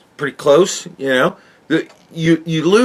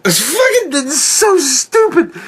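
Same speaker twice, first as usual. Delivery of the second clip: laughing disbelief; 11 semitones higher than usual. Real, not acted. Ranting about heart plugs.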